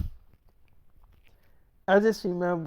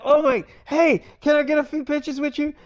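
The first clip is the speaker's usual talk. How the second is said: much higher, louder, fast; starstruck kids; 12 semitones higher, 8 dB louder than usual. Retold or imitated. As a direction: gush at the celebrity. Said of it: "they was like".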